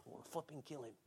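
mock grumble. Teen grumbling about dad.

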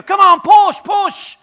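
high shouted push, half laughing; coach shouting. Acted. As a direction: yell it from the bedside. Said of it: "You got someone at the side coaching you".

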